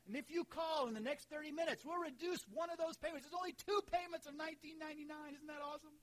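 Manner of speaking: high, loud TV pitchman; infomercial. Pretend. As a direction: hype it like a late-night ad. Mock TV offer, easy payments.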